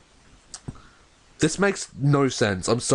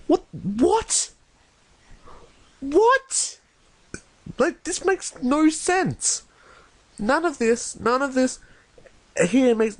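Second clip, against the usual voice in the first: incredulous; 13 semitones higher, slower than usual. Real, not acted. Reader baffled by story's new characters.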